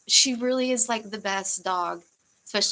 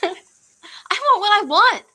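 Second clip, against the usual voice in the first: high, loud spoiled whine; bratty demand; 11 semitones higher, 9 dB louder than usual. Pretend. Streamer jokingly insists to chat, bratty high whine. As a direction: stamp your foot like a spoiled kid.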